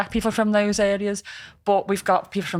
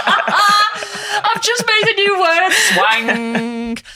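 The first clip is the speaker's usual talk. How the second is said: laughing; 13 semitones higher, 6 dB louder, much slower than usual. Real, not acted. Laughing at own made-up word.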